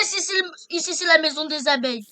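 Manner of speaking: shouting. Real, not acted. Defending the bee house.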